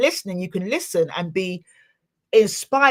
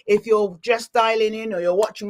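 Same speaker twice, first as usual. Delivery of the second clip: ordinary talk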